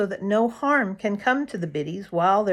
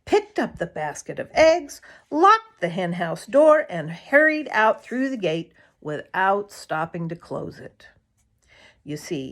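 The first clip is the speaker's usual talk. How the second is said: ordinary delivery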